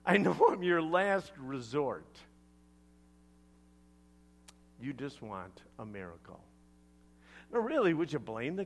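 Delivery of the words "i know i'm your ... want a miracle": a touch of weary-paced sarcasm; sarcastic. Acted. Jesus answers a man seeking signs, mildly sarcastic. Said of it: "sarcastic tone".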